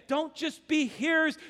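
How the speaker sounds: normal talking voice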